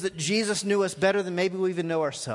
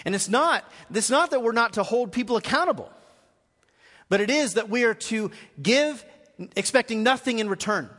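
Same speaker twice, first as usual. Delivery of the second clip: preaching at full force; 4 semitones higher than usual. Acted. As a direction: drive the eternal debt home from the pulpit.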